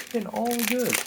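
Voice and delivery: lifted high, softly gloating "goods"; playful high-pitched crowing; high sing-song. Real, not acted. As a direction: repeat it in a high, pleased lilt. Repeats the phrase in higher voice.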